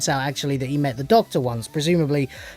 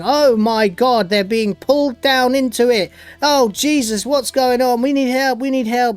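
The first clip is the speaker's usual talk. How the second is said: higher-pitched mock alarm; acted cry for help, pitched up; mock panic; 6 semitones higher than usual. Soldiers being pulled down into mud, mock panic.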